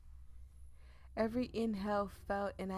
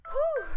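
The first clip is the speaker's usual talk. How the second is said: startled whew; 11 dB louder than usual. Real, not acted. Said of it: "My phone scared me".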